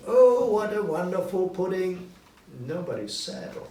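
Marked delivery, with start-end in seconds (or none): 0.1-2.1 louder, higher, full of delight; delighted family. A narrator's reading. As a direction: praise the pudding with joy. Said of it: "they said".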